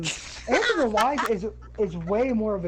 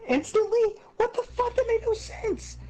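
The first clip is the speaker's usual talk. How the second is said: much higher, indignant and exasperated; outraged disbelief; 14 semitones higher than usual. Real, not acted. Fan venting at the game, high pitched outrage. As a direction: squeak it out in angry disbelief. Gamer ranting about Sora giving up.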